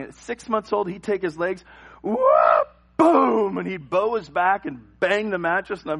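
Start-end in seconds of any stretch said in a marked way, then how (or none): 2.0-3.6 loud high whoop, then a booming thud; explosive sound effects; imitated leg slam. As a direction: act out a swing and crash. Imitates baby slamming legs down.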